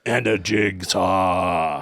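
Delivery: very slow, low eerie drawl; ghost voice. Retold or imitated. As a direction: stretch it out like a ghost. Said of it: "You sound like a ghost".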